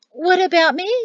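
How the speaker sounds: put-on high, loud demand from an old woman. A narrator's reading. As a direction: butt in, wanting attention. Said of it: "Serafina demanded".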